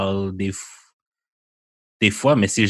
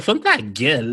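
ordinary talk, nothing marked

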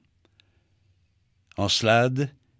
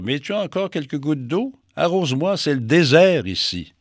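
tag; 6 semitones higher, 5 dB louder than usual. Acted. Mercure supplie qu'on l'arrose.

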